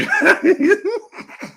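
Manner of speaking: very high helpless laugh; high-pitched, teary cackle; laughing to tears. Real, not acted. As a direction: burst back into real laughter. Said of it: "I'm literally in tears".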